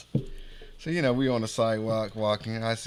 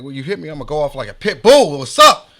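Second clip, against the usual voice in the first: loud, high retold challenge building to a shout; re-enacted pit bull threat, fast then exploding; hyped-up challenge; 4 semitones higher, 14 dB louder than usual. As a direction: build to a fired-up yell. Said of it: "I'm all hyped up".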